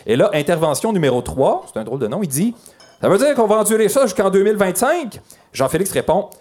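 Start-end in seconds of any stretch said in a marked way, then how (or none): 3.0-5.2 higher voice, mildly indignant question; protesting citizen. Acted. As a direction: object as a put-upon local. Acted citizen protesting a delay.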